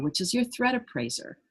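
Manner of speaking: ordinary talk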